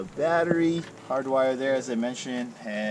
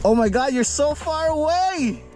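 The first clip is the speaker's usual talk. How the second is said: real delighted exclaim, much higher; thrilled disbelief at the radio; excited; 4 dB louder than usual. Real, not acted. Testing the new CB with Chris, high excited exclaim. First CB radio test works.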